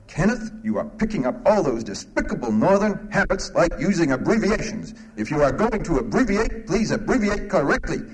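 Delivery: high, sing-song grandmother voice; scolding Southern grandmother. Acted. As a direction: correct him primly, pitch swooping high. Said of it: "his grandmother, who was very much concerned ... And she said".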